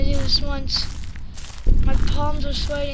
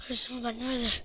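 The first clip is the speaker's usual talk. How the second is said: soft low murmur; 5 semitones lower, 15 dB quieter, faster than usual. Real, not acted. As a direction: mutter to yourself. Very soft searching.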